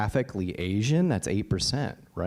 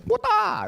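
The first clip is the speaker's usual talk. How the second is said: high, loud mock attack yell; mock battle cry; 6 dB louder than usual. Imagining vaulting the agent's desk, hammed-up battle yell. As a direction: leap the desk with a cartoon war cry. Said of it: "I was gonna vault the desk".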